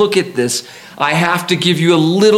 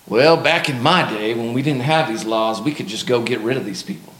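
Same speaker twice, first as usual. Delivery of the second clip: put-on low Southern old-timer voice; old-timer's Southern accent; 6 semitones lower, 3 dB quieter than usual. Preacher voicing an old-timer reminiscing about revenge, Southern accent. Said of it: "I gave him a southern accent".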